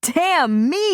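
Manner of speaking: surprised. A narrator's reading. Surprised delight.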